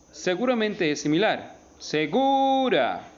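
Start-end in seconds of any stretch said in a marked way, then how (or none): 1.8-3.1 "Segura" with GU held long and high; stress on GU. Shown as a demonstration. Teacher showing the penultimate accent, "Segura" with stretched high GU. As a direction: draw out the middle syllable GU of "Segura", pitched up. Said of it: "tiene el acento en la penúltima sílaba".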